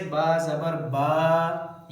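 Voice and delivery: final "बा" held too long, stretched out; wrongly drawn-out vowel. Shown as a demonstration. Teacher showing pupils the stretching mistake, "बा" drawn out. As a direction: drag the vowel of the last "बा" well past its short length. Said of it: "खींचने की मिसाल जैसे".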